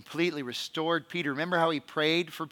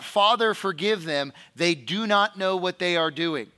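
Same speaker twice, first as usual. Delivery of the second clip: loud preacher's cry, raised voice; yelled plea; 3 semitones higher, 5 dB louder than usual. Acted. Jesus crying to his Father from the cross, loudly pleading. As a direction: call it out as from the cross. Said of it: "on the cross yell out".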